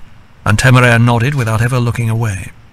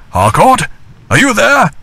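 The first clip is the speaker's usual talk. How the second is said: raised, higher call into the clearing; strong call; 10 semitones higher than usual. A narrator's reading. Laurence calling into the dark clearing for Harcourt. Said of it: "calling in a strong voice".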